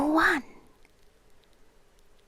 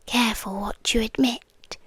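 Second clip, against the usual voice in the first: ordinary delivery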